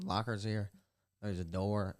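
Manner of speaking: ordinary talk, nothing marked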